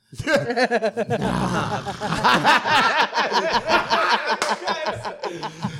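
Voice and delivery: long, loud high laughing fit; uproarious laughter. Real, not acted. Everyone cracking up at the denial.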